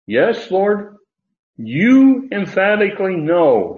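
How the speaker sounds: high, loud, long "you"; emphatic YOU. Acted. Preacher voicing Peter answering Jesus, emphatic YOU. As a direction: raise the pitch and drive "you" home. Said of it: "He's using that emphatic pronoun again".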